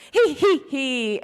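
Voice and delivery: high, loud, slow he he he; fake giggle. Class imitating the teacher's forced giggle. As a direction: squeeze out a staged giggle. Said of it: "everybody's doing the noises".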